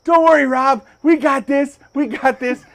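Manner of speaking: high, loud rallying shout; Kevin. Retold or imitated. Storyteller voicing his friend reassuring him at the ramp, rallying. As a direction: imitate an overconfident helper.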